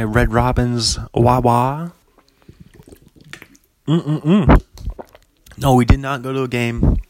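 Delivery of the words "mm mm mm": sipping hum. Real, not acted. Sipping a drink.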